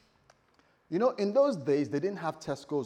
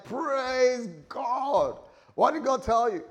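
loud, high, jubilant reply; excited rejoicing; 10 semitones higher, 6 dB louder, slower than usual. Acted. Pastor hearing of a visitation, loudly thrilled. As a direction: exclaim it high and loud, eager for the news.

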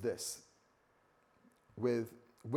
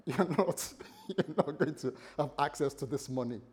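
chuckling as you lay down the law; amused, laughter breaking into the words; laughing refusal. Acted. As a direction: laugh through the wife's mock refusal. Laughing while acting the refusing wife.

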